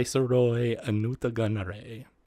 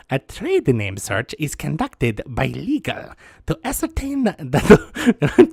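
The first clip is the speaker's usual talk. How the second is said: Doctor Doofenshmirtz; 4 semitones higher, 6 dB louder than usual. Acted. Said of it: "sounds like Doctor Doofenshmirtz".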